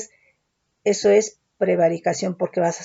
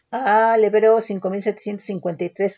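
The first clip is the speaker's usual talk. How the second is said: normal talking voice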